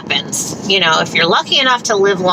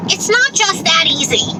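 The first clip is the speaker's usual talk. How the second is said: exasperated; 8 semitones higher than usual. Retold or imitated. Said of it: "And I'm like".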